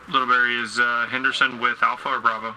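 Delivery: loud call across the convoy. Real, not acted. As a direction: shout it over the engine noise. Calling out across the vehicles.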